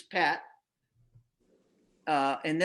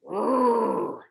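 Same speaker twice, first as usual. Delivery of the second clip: Rrr growled like a lion, louder, rising; 5 semitones higher, 3 dB louder than usual. Shown as a demonstration. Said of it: "what I use for that is a lion. Watch me".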